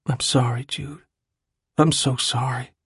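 soft, broken apology; tearful apology. Acted. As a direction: murmur it near tears. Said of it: "he repeated to himself".

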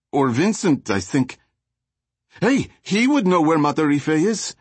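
high, chatty street-thug voice; gangbanger voice. Acted. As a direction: babble it all out like a jittery thug.

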